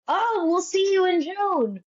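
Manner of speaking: high, sing-out cheer; mock a delighted send-off, pitched high; imitated delight. Casting director hinting at the job.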